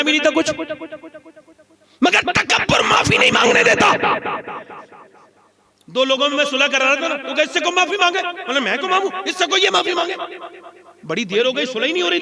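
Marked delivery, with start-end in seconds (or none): 2.0-3.9 high, loud ringing peak; preaching climax. Acted. Climax line of sermon. 7.4-10.1 higher, petulant bickering; stubborn quarrelers. Retold or imitated. Two quarreling men voiced.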